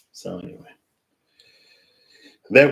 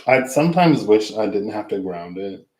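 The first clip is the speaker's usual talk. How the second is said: ordinary talk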